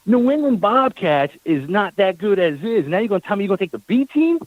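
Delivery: mock the idea of a B team; incredulous scorn. Complaining to the coach about the B team, scornful disbelief. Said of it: "I'm like".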